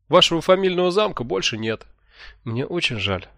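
Чифа, cheerful. A narrator's reading. Чифа announces the castle is gone, cheerfully unsorry. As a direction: report bad news as if it were very good. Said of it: "Столь жизнерадостным тоном обычно сообщают".

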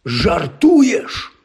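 high-pitched, incredulous exclamation; astonished. A narrator's reading. Ron hearing Harry's news at dinner, astonished. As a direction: blurt it out in sheer disbelief.

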